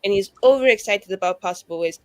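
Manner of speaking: ordinary delivery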